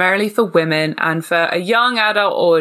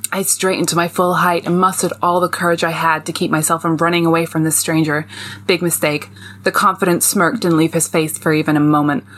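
put-on American accent; 2 semitones lower than usual. Reading a vampire novel excerpt to co-hosts, American accent. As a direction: read it in your best put-on American. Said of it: "pull in my best American accent".

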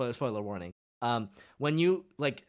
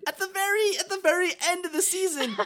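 normal talking voice

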